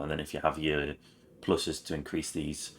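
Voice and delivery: ordinary talk, nothing marked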